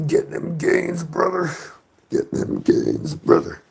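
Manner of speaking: high, pumped-up gym bro hype. Pretend. Greeting Grayson after his gym session, pumped-up gym bro hype.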